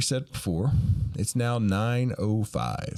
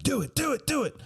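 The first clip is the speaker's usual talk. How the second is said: high, urgent egging on; 13 semitones higher than usual. Retold or imitated. Prankster urging Murr to steal the car, high chant. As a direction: chant it at a friend to dare him.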